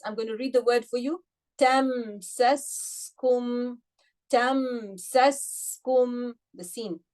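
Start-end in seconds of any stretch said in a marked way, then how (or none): 1.6-6.4 tamsaskum slow, the s let run with air; flowing s. Shown as a demonstration. Teacher recites to class with flowing s. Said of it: "rakhawa, flow of air".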